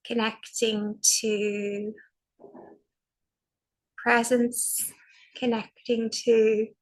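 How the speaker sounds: slow, spacious meditation voice with long pauses; guided meditation. Acted. Teacher guiding a sitting practice, slow and soothing. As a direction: guide the group into calm, one phrase at a time.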